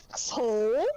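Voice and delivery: playacted yell at a corpse; mock angry yell. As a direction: curse at a fallen foe in mock outrage. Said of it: "I'm just yelling at the corpse".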